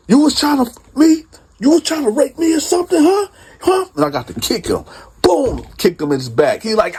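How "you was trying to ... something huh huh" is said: angry accusation. Retold or imitated. Accusing the cellmate, shouting.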